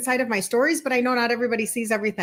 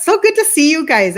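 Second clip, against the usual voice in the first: loud, high, gushing welcome; excited; 5 semitones higher, 9 dB louder than usual. Real, not acted. Host thrilled to see viewers joining, excited. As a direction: beam at your viewers. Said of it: "I get very excited".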